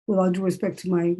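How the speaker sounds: ordinary delivery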